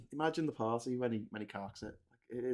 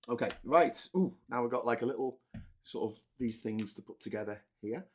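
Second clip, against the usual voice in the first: ordinary delivery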